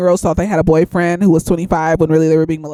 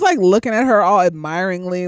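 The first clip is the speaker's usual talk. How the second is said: ordinary talk, nothing marked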